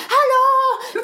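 high, loud shout to wake him; mother shouting to wake her child from night terror, loud and high; sharp shout. Retold or imitated. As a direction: snap a loud hallå to jolt him awake.

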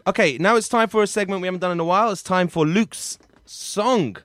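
high, loud host announcing; segment announcement. Real, not acted. Segment intro.

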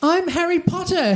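a mock heroic yell. A narrator's reading. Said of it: "Harry began yelling".

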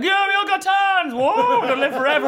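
mock high dopey voice, gushing; silly naive kids. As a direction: squeak it like clueless happy children. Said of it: "we're like".

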